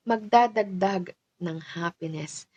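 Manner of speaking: usual speaking voice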